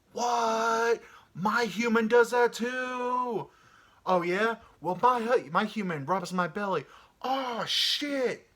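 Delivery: high, loud, amazed dog bragging; a jealous dog outdoing his friend; excited dog voice. Pretend. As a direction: shout it like a hyped-up dog.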